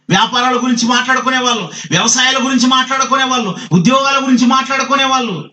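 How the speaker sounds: fast, high-pitched preacher's list; forceful preaching. Real, not acted. Preacher lists what people chatter about, forceful preaching.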